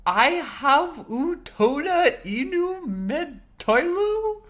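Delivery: slow, puzzled read of made-up words; fake foreign language. Real, not acted. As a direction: sound out I have u toda inu med toilu. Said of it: "What is this supposed to mean?".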